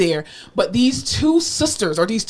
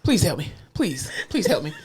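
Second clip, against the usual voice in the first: low, softened imitation of the patient; calm pleading; 5 semitones lower, 6 dB quieter than usual. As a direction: beg for help without any urgency. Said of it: "just calmly".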